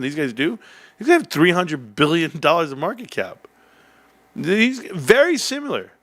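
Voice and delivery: excited. Real, not acted. Pitched-up excited stock rant.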